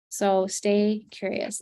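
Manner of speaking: slow, drawn-out catchphrase; lingering, deliberate sign-off. Real, not acted. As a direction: stretch each word out as a farewell. Closing catchphrase to audience.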